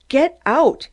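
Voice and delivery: a high, loud scream. Acted. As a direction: scream it at him, furious. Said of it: "he screamed".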